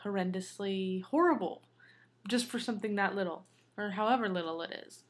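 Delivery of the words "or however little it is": normal talking voice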